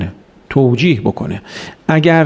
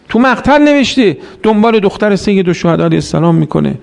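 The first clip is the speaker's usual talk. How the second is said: ordinary talk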